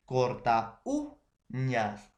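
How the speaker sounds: "corta uñas" stretched slowly, "u" held and stressed. Shown as a demonstration. Teacher confirming where the force falls, slow stress on "u" in "corta uñas". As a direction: pull the syllables apart, leaning on "u" in "uñas". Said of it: "dónde lleva la mayor fuerza de voz".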